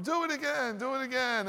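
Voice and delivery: put-on high, eager child begging; excited kid. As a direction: beg like a kid in the pool. Kids begging dad at pool.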